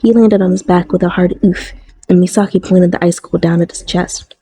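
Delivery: usual speaking voice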